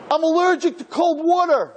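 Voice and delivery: lightly put-on child voice, high; boy's reveal. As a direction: voice the boy, pitched far up. Boy's surprising answer voiced.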